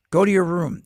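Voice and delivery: loud, commanding parent voice; stern parent order. Retold or imitated. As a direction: bark it like a strict parent sending a kid off. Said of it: "That's kind of a cult".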